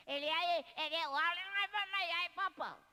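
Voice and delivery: high, loud playful singsong; excited high call. Real, not acted. Teasing the child in a high loud singsong.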